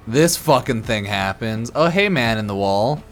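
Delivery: ordinary delivery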